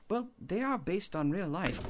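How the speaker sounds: usual speaking voice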